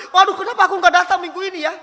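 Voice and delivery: high, loud, hurried lament; regretful wail. Acted. Churchgoer who skipped Sunday service, acted regretful dismay. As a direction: blurt it out in dismay, voice up. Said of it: "saudara menyesal banget".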